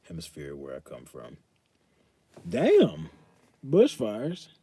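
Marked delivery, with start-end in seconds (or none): 2.5-4.5 sudden high, loud damn; surprised exclaim. Real, not acted. Reacting to chat's bushfire news, surprised exclaim. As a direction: react like the news just hit.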